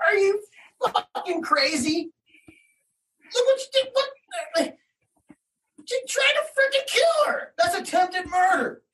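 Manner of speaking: very high-pitched, indignant protest; outraged disbelief. Retold or imitated. Parent protesting that court ignored attempted murder, outraged disbelief. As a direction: cry out at the injustice, voice way up.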